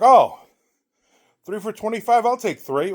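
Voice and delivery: loud, high "oh", then a high-pitched offer; imitated eager buyer. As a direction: pipe up like a keen bargain hunter. Imitating keen customer at his table.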